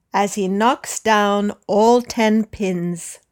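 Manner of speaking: measured listen-and-repeat reading; dictation read. Shown as a demonstration. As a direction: read it out in short chunks, pausing for repetition. Slow dictation read with long pauses.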